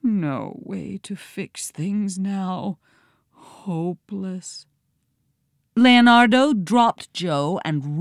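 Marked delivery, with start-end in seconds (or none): 0.0-4.7 soft, slow, hopeless whimper. A narrator's reading. Captured pig giving up, soft whimper. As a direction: whimper it in defeat. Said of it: "Genius Kelly whimpered".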